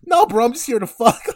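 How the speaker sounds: high, loud imitation of a panicked guy; hammed-up protest, cracking up; mock freak-out. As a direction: blurt it out through laughter. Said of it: "Cole freaks out. He's like".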